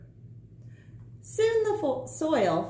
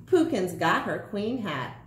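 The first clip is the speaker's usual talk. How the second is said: plain reading voice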